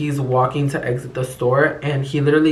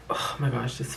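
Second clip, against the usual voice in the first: disgusted aside; 2 semitones lower, 10 dB quieter than usual. Real, not acted. Recalling the sicko's approach.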